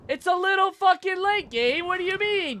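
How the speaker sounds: very high-pitched, exasperated protest; incredulous outburst. Real, not acted. Player protesting a too-late warning to Gabe, incredulous. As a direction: squeal it in disbelief.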